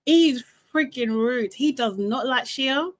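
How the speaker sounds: usual speaking voice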